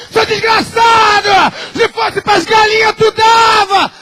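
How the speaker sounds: high, loud, slow furious yell; furious shout. Acted. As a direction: scream at the husband in a rage. Wife screaming at husband. (esposa gritando furiosa com o marido)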